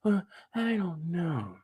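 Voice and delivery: high, soft, slow hesitation; mock hesitant. Woman answers the man with the wine, mock hesitant. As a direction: play her unsure, drawn out softly. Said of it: "She talking about".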